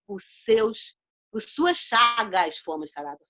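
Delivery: slow, loud, measured Bible reading; solemn scripture reading. Acted. Preacher reading the verse to the congregation, slow and solemn. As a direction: read each word weighty and deliberate.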